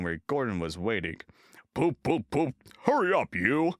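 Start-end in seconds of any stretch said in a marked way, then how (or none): 1.7-2.5 loud cross poop poop; big engine whistling loudly and crossly; big engine whistle. A narrator's reading. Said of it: "said Gordon crossly". 2.8-3.7 loud, high cross order; big engine crossly hurrying Thomas, loud. A narrator's reading. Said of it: "said Gordon crossly".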